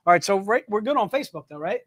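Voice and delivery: ordinary delivery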